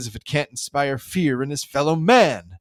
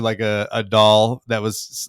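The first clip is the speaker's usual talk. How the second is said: ordinary talk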